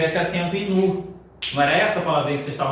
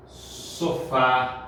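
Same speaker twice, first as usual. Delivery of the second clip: slow dictation; 4 semitones lower, 5 dB quieter, much slower than usual. Shown as a demonstration. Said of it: "dictating example words slowly".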